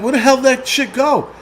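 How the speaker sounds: startled disbelief. Retold or imitated. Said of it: "I'm like".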